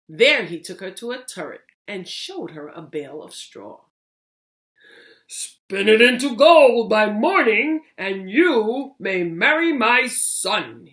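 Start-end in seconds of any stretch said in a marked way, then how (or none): 5.3-10.9 loud, slightly higher royal command; king giving a grand ultimatum. Acted. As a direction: order her like a king who expects results. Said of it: "he said".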